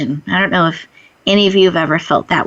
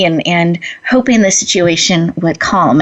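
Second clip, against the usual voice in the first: normal talking voice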